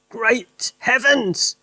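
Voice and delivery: high, slow excited cry; Mister Cranky cries out, high and slow. Acted. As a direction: exclaim it in alarm. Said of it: "he cried".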